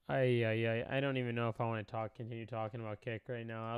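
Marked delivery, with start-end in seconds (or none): none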